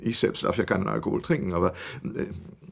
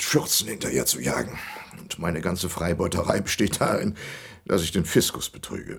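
ordinary delivery

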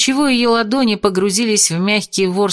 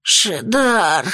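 прохрипел. Acted. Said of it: "прохрипел бес".

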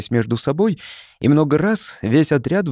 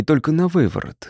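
usual speaking voice

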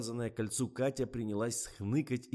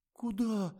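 terrified; 7 dB louder than usual. A narrator's reading. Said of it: "почти до обморока перепугалась Катя".

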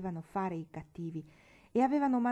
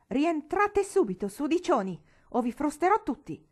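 furious yell; 8 semitones higher, 9 dB louder than usual. Acted. Father yelling at the children in the snow, furious. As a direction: roar at the children. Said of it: "egli gridò".

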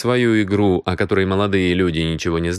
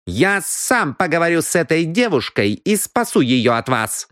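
put-on firm young man; 8 semitones higher than usual.

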